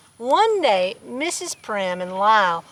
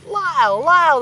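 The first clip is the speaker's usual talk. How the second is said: called out across the store; calling out; 6 semitones higher, 6 dB louder than usual. A narrator's reading. Old friend calling Lyle across the store, calling out. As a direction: call his name over the crowd. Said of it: "the voice called out".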